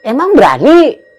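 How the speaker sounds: high, loud challenge; incredulous question. Retold or imitated. Ojek driver asks if he really dares, incredulous. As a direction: imitate someone doubting his nerve.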